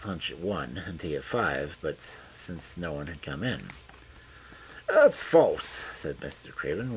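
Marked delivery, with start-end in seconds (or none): 4.9-5.7 higher, louder, sharp accusation. A narrator's reading. Old master to the detective, sharp and unusual tone. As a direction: snap it out sharply. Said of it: "with a sharp, unusual sort of tone".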